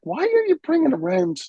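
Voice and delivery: high, disbelieving quote; incredulous question. Retold or imitated. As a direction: ask it like it's absurd. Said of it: "she was like".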